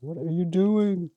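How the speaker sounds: angry worm. Pretend. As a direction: squeak out the worm's protest, offended. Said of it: "making a bunch of worms probably really angry".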